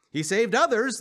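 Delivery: high, loud scoff. A narrator's reading. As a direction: jeer at him as the elders. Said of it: "they scoffed".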